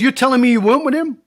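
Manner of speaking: loud, high, disbelieving question; incredulous challenge. Retold or imitated. Acted quarrel, disbelieving the denial.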